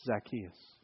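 soft, low and tender, calling his name; loving call. Acted. Jesus calling Zacchaeus down from the tree, soft and tender. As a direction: say the name gently, like an embrace. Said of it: "lovingly, graciously says".

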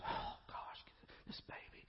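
hushed, irritated whisper; the judging congregation whispering about the crying baby; annoyed whisper. Retold or imitated. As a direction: whisper it with a disapproving sigh. Annoyed churchgoers muttering about baby.

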